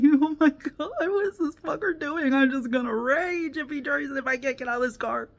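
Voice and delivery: freaking out inside the car; panicked inner thoughts. Retold or imitated. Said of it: "I'm like".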